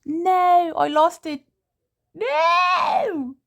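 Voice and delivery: high, loud exasperated no; real frustrated outburst; frustrated shout. Real, not acted. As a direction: yell at the lost page. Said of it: "I lost my fucking page".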